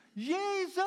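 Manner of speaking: high, stretched-out desperate call; cry in need. Acted. Preacher showing how you call in need, desperate cry. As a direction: call the name out in dire need. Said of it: "Maar als je nood hebt".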